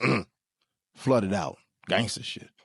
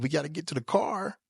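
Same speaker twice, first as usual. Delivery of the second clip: usual speaking voice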